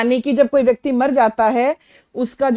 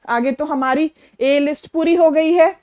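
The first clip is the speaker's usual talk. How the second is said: ordinary talk, nothing marked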